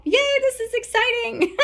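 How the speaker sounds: very high "yay" breaking into laughter; giddy cheer with a laugh. Retold or imitated. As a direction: cheer like a delighted kid. Donkey cheering while being helped.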